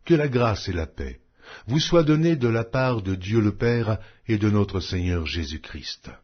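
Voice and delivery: ordinary delivery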